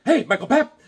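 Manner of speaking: high, loud mock-cheery name call; teasing greeting. Teasing a coworker after hearing his voicemail, high loud greeting. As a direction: sing out the name to needle him. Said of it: "I just, like".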